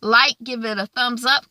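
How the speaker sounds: ordinary delivery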